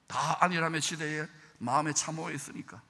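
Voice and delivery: usual speaking voice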